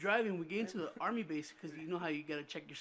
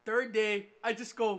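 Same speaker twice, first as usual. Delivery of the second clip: usual speaking voice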